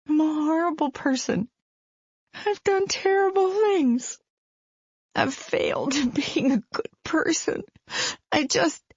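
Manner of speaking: a mother breaking down in guilt; lightly voiced despairing mother; guilt-ridden mother. Faith's guilt-ridden outburst.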